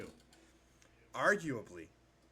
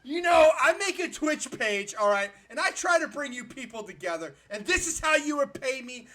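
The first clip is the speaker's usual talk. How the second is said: raised-pitch, aggrieved tirade; indignant rant; 6 semitones higher, 5 dB louder than usual. Real, not acted. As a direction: berate the ungrateful audience. Mock tirade at his chat.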